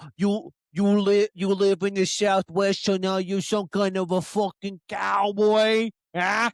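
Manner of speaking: put-on East Coast accent, heckling; challenging East Coast accent impression; mock East Coast accent. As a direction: rib someone in an exaggerated East Coast accent. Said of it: "being from the East Coast".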